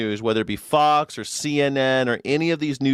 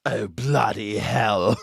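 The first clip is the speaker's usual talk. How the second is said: mock English accent; much slower than usual. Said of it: "They're like".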